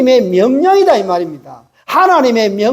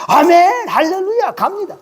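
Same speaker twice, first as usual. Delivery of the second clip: high-pitched, eager amen; mock eager believer; 8 semitones higher than usual. Congregant claiming to obey God at one word, mock-eager and high. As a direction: pipe up as the instantly obedient believer. Mimics an instantly obedient believer.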